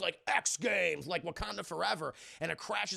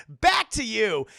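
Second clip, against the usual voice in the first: loud, higher shout; sudden switch to broadcast voice; announcer's abrupt sign-off; 4 semitones higher, 11 dB louder, slower than usual. Retold or imitated. Announcer handing back after gloating, abrupt loud sign-off.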